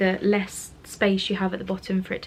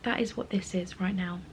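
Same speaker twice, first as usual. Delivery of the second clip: normal talking voice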